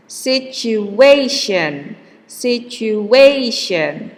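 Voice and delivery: Situation said slowly with the A syllable drawn out; slow stress demo. Shown as a demonstration. Teacher to class, slow careful Situation. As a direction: stretch Situation and lean on the A. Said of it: "The stress is here".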